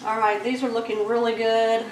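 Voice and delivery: normal talking voice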